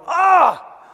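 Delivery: sudden high yelp of dismay; a caught-yourself "Ah!" jumping up high; mock dismayed gasp. Catching himself mid-complaint, a sudden high "Ah!". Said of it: "words coming out your mouth and going".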